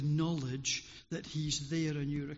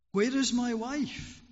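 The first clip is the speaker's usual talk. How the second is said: higher, louder cry for his wife; desperate call; 6 semitones higher, 5 dB louder than usual. A narrator's reading. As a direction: shout into the darkness. Lost man calls in darkness.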